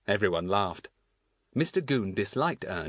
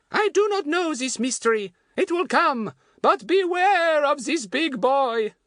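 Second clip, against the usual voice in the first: high, slow, ominous French warning; French woman's accent; 7 dB louder, slower than usual. Acted. Fatty re-enacting his warning to Goon, French woman's accent. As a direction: intone a louder warning in a put-on French accent.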